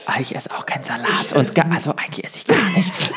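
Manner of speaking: secret whispered confession; whispering. Retold or imitated. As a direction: whisper it on the sly, laughing. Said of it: "Und dann so heimlich".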